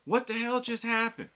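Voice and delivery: high, loud exasperated outburst; hammed-up rage at the ruined painting; mock fury. Teacher acting out a frustrated student, high loud mock fury. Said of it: "You're all mad".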